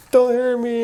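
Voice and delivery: high mock whimper; acted-out cowering, pitch way up; weak, pleading. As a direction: imitate a helpless plea in a high voice. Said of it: "I'm being weak and everything".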